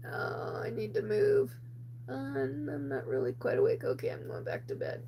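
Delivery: drowsy, breathy half-asleep mumble; barely awake, murmuring herself back to bed; sleepy whisper. Retold or imitated. Woken at night, she whispers drowsily to herself.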